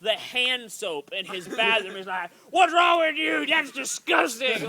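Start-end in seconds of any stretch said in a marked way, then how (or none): 2.5-4.7 mock dad yell. Dad scolding over hand soap.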